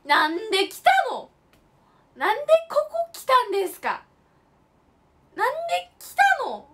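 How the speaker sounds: high, loud incredulous cry; exasperated outcry. Real, not acted. Complaining to viewers about the pointless trip, incredulous. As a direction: howl the question in disbelief.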